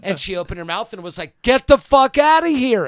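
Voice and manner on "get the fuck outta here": loud, high, slowed scream. Retold or imitated. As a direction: scream it at the woman, every word drawn out. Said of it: "And now I'm screaming".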